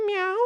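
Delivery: high cat meow; short high imitation cat. Shown as a demonstration. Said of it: "we've considered a cat-sized cat".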